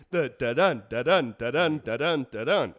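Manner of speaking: da-dun with stress on dun; da-dun da-dun in steady iambs; iambic da-dun. Shown as a demonstration. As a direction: beat out the iamb. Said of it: "it's stressed and unstressed".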